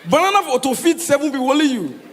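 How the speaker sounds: exasperated exclaim. Retold or imitated. Comedian exclaiming in disbelief.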